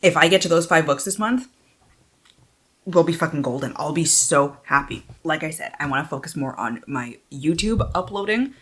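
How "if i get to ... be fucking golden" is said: ordinary talk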